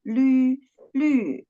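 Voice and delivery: "Lü" falling sharply from high, said twice; teacher repeats the falling lü for students; fourth tone lü. Shown as a demonstration. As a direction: model "lü" with a crisp falling tone. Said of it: "the fourth tone".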